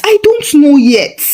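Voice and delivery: usual speaking voice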